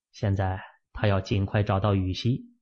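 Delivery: ordinary delivery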